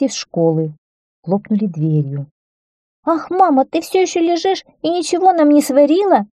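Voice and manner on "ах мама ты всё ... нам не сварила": put-on high-pitched childish reproach; reproachful girl. A narrator's reading. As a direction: scold mum as a put-out little daughter. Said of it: "укорила её девочка". (укоризненно, высоким девичьим голосом)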